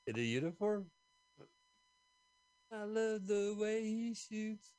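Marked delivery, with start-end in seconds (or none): 2.7-4.7 slow, much higher swoon; mock swooning. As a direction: gush over the cop. Teasing about the manly cop.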